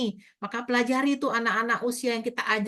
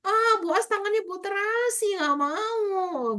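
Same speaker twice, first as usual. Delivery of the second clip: put-on high, slow whiny kid voice; imitating a fussy child; 11 semitones higher, much slower than usual. Child refusing teacher's smelly hand, high whiny voice. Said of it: "Ah, salam, udah salam kan".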